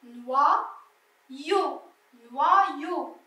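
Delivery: noyau drawn out as "noua-yo"; syllable-by-syllable pronunciation. Shown as a demonstration. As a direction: split noyau slowly into "noua" and "yo". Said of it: "ça fait wa".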